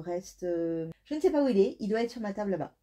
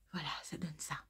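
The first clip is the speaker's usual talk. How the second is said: soft unvoiced reveal; whispering; 3 semitones lower, 12 dB quieter than usual. Real, not acted. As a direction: hush it while showing. Showing color, whispered.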